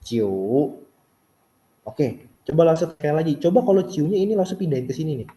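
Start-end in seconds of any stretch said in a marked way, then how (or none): 0.0-0.3 CIU falls, then rises; falling-rising CIU; full third tone. Shown as a demonstration. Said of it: "turun baru naik".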